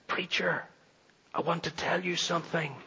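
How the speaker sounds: faint, frail voice of a sick man; barely audible, straining to speak; weak voice. Retold or imitated. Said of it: "he said in a weak voice".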